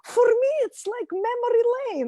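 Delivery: put-on high-pitched mom with a Russian accent; Soviet mom accent. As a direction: imitate your Russian-accented mother protesting. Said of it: "my mom's from the Soviet Union".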